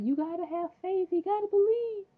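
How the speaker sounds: very high, hammed-up kid's plea; imploring boy. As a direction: squeal it like a child begging for belief. Acting Henry's desperate plea.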